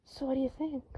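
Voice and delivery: soft whispered question; hushed, intimate question. A narrator's reading. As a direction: breathe it against his lips. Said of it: "He'd whispered against Draco's mouth".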